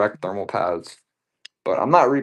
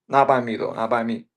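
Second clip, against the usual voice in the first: ordinary talk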